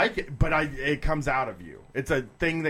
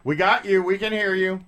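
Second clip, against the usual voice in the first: raised, louder voice to reach a caller; calling out; 5 semitones higher, 5 dB louder than usual. Real, not acted. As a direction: call out to someone on a bad line. Reassuring caller on a bad connection.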